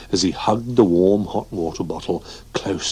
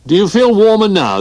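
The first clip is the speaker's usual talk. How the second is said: raised calling voice; 13 semitones higher, 7 dB louder than usual. Acted. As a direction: call it high and loud through the sheet. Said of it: "they called".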